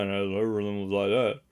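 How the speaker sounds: very low, soft rumble imitation. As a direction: drop right down into a deep crooner register.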